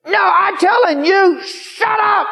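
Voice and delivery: very high, loud screech, acted out; angry yell. Old woman yells at the preacher, screeching. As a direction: scream them down, drawn out and high. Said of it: "having people yell at me".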